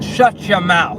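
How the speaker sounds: retold angry command. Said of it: "pointed at that girl ... and said".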